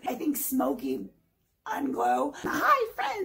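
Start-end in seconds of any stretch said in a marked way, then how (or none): 2.4-3.3 high-pitched, sugary mock greeting. Mimicking a rival YouTuber's friendly opener, mocking. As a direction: imitate a YouTuber's high, fake-friendly hello. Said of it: "You can't talk like that".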